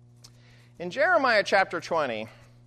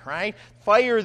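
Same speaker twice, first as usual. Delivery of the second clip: ordinary delivery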